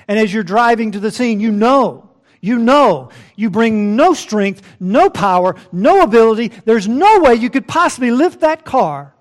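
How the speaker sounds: emphatic pulpit crescendo; impassioned preaching. Real, not acted. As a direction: preach it with rising force. Preaches at full force.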